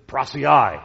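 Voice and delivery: prasiai said carefully as PRAH-see-eye; Greek word prasiai. Shown as a demonstration. Preacher teaching the Greek word prasiai, said carefully. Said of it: "the Greek word is".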